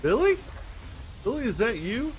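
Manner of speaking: high, questioning call; Sam calling out. Acted. Sam to a figure at the woods, high questioning call.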